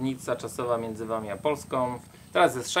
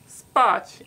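"Spać" called out high and loud; demonstrating to locals; 11 semitones higher, 10 dB louder than usual. Shown as a demonstration. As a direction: shout "Spać" loud and high so foreigners understand. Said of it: "No to pokazujemy".

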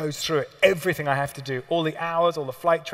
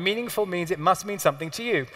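normal talking voice

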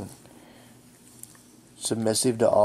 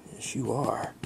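normal talking voice

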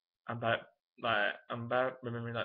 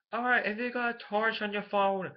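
higher-pitched attempt at an Irish accent; Danny; 7 semitones higher than usual. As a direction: put on an Irish singer's stage voice. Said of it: "this is just how he sounds apparently".

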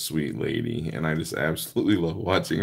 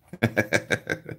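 breathy laugh; 6 semitones higher than usual. Real, not acted. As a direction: laugh at her own joke. Laugh after joke.

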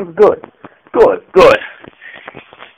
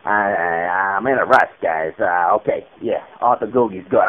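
much lower, hesitant mumbling bandmate; Arthur's low voice; 11 semitones lower, 9 dB quieter than usual. Acted. Bandmate turning down a stage name, in a low grumble. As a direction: drop way down and grumble reluctantly.

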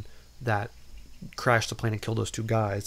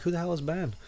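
baffled; 7 semitones higher, faster than usual. Retold or imitated. Listener's baffled reaction.

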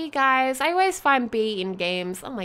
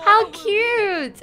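1 squealing delight; 8 semitones higher than usual. Real, not acted. Laughing at cute sims.

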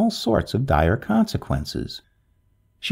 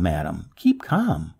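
slow, softer keep calm; reassuring; 6 semitones lower, 4 dB quieter, slower than usual. A narrator's reading. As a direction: settle her down gently. Repeats softer, slower.

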